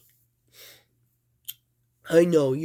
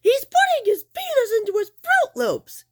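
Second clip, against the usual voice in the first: very high, louder, giggly tattle-tale voice; squeaky tattling; 4 dB louder, faster than usual. Pretend. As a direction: squeal the gossip like a cheeky toy. Toy play, gleeful tattling.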